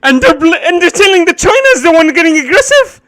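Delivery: loud, high, incredulous outrage near tears; outraged yelling. Real, not acted. Streamer to viewers about the clip, loud outraged disbelief. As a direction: shout it in disbelief, voice cracking.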